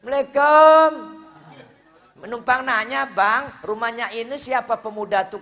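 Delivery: high, loud woman's voice; polite woman asking. Acted. Woman asking neighbours where the young man lives, high-pitched. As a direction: call out the greeting high and loud.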